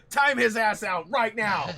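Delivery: loud, high drawn-out shout. Pretend. To chat about the talking parts, shouted high. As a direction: yell it like a heckler, stretched out. Mock demand to eject someone.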